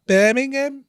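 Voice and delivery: high-pitched mock way of saying Birmingham; mocking Birmingham pronunciation. Mocking a mate's way of saying Birmingham, exaggerated accent. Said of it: "if I was mocking you, I'd be like".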